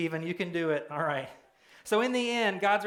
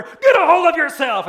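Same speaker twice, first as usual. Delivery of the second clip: even louder, higher frantic bellow; harder shout; 10 semitones higher, 14 dB louder than usual. Retold or imitated. Next passenger shaking her harder, louder frantic bellow. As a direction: yell it with more force. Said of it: "he shakes her harder".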